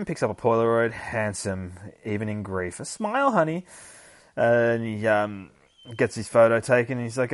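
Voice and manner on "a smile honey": much higher, lightly sweet mother voice; voiced mother line. Streamer voicing the mother at the photo, high sweet tone. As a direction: coax a smile like a mom.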